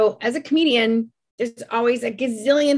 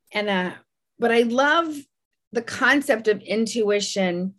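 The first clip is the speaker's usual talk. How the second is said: normal talking voice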